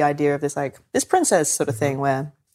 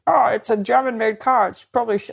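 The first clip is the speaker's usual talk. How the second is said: mock xenophobe; 5 semitones higher than usual. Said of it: "All this, like".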